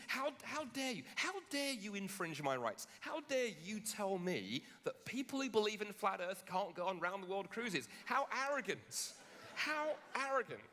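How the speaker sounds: high, slow, hammed-up indignation; mock outrage. Flat earther Tom takes offence at his friends, hammed-up high outrage. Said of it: "he gets all upset and aggravated".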